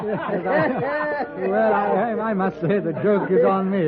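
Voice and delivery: flustered. Acted. Englishman flustered after being fooled.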